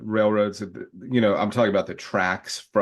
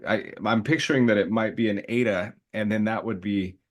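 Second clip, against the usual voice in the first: ordinary talk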